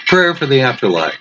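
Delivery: usual speaking voice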